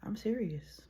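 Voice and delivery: hushed serious whisper. Real, not acted. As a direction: lean in and whisper it. Whispered aside to viewers.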